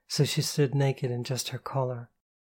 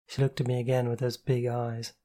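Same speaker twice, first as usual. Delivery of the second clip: ordinary delivery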